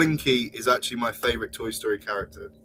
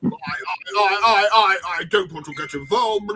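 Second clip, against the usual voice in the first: Boris Johnson; 5 semitones higher than usual. Acted. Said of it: "can you do Boris Johnson".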